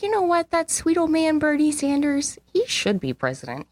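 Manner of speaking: high mock-admiring voice; mock naive past self. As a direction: gush like a starry-eyed fan. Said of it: "I was like".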